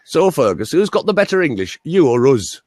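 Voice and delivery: put-on high, challenging Scottish accent; Scottish accent, lifted and punchy; Scottish farmer. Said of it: "about nine Scottish farmers".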